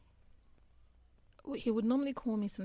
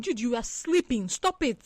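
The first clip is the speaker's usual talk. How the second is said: louder, higher, fed-up scolding; raised exasperated voice; 3 semitones higher, 11 dB louder than usual. Retold or imitated. Retelling the late-night call, she scolds her sleepy friend loudly. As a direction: snap at him to stop pretending. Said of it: "And I'm like".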